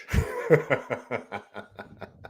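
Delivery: low, quiet chuckle. Real, not acted. Amused by his own Bush push nickname, chuckling. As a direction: laugh to yourself, low.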